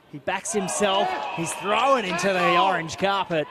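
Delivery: loud, rising burst of play-by-play; excited commentary. Real, not acted. Commentator calling a player backing himself, excited shout. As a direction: belt out the call as the player goes. Commentator calls a sudden attacking move.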